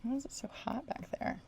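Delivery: soft unvoiced whisper. Real, not acted. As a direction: whisper it to yourself. Whispered aside.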